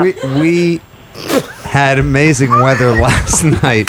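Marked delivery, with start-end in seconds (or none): 1.6-3.9 stifling laughter mid sentence; barely holding back a laugh; trying not to laugh. Real, not acted. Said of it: "She has no idea".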